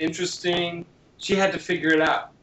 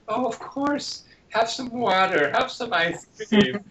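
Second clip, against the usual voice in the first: high, overly sweet pretend parent; child playing the parent, pitched up; indulgent parent; 8 semitones higher than usual. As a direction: gush like a too-nice parent. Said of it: "the child will say".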